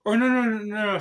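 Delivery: startled self-correction; sudden correction. Real, not acted. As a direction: catch your own mistake out loud. Correcting herself mid-story.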